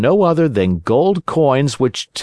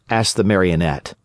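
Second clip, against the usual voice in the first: plain reading voice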